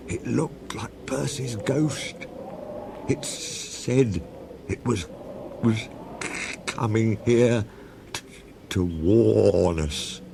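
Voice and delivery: slow, stammering and scared; shaky voice. A narrator's reading. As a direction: stutter it out in fear. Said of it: "said Toby in a shaky voice".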